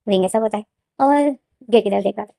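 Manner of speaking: ordinary delivery, nothing marked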